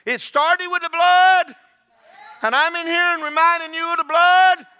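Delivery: high, loud, ringing proclamation; triumphant shouted prayer. Retold or imitated. Believer rising from communion table, triumphant shout. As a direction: shout your victory to God. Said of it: "And you go".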